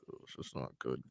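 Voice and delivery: hushed, whispered dismay; whispered aside. Real, not acted. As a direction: mutter it under your breath. Aside while reading salaries.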